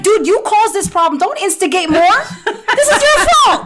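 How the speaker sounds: high, loud exasperated comeback that climbs into a laugh; indignant retort. Retold or imitated. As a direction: fire it back at the interviewer, half laughing. Retorting to German interviewer.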